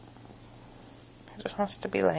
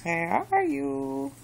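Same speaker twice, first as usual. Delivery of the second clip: put-on talking to a toddler; 7 semitones higher, slower than usual. Slow sing-song to cat.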